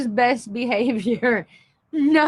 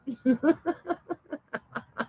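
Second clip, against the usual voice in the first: helpless laughter; 3 semitones higher, 7 dB quieter than usual. Real, not acted. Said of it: "I'm sorry".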